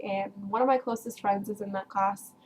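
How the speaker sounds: ordinary delivery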